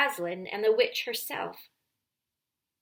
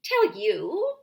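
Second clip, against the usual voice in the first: higher and louder scornful witch; mock the question with rising scorn; shriller witch; 4 semitones higher, 6 dB louder than usual. A narrator's reading. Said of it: "voice growing suddenly shriller".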